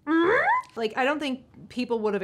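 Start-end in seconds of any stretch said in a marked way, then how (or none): 0.1-0.4 high, loud rising "mm?"; incredulous hum. Retold or imitated. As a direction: hum a baffled question. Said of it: "I'm like".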